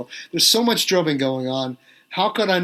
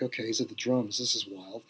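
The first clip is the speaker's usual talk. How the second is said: muttered to himself under his breath, much softer; awed private aside, barely voiced; hushed inner thought; 5 semitones lower, 10 dB quieter than usual. Drummer to himself spotting Phil at the kit, hushed.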